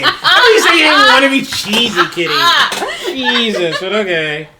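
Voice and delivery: high, loud, through real laughter; helpless laughing outburst; laughing exclamation. Real, not acted. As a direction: crack up while talking. Cracking up at cheesy line.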